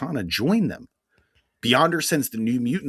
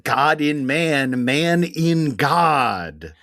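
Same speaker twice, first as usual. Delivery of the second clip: dramatic title read; 3 semitones higher, 4 dB louder, much slower than usual. Acted. Reading the comic's title.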